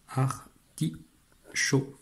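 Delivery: "Artichaut" very slowly, a long gap after each syllable; split into syllables. Shown as a demonstration. Teacher marking the syllable arcs, each part separate. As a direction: isolate ar, ti, chaut in "Artichaut". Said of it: "trois syllabes".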